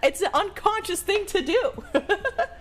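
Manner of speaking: high laughing protest. Real, not acted. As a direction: laugh through the words.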